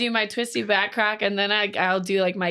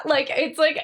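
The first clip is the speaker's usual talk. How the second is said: usual speaking voice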